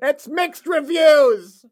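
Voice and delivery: high, loud, slow verdict; critic voice. Retold or imitated. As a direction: deliver it like a reviewer.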